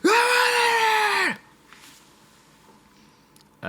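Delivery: high screamed blur, words run together; one blast of sound. Shown as a demonstration. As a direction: scream the words as a single long roar. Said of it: "it's just one log of sound".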